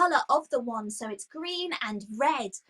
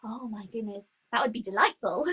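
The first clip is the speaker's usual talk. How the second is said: ordinary talk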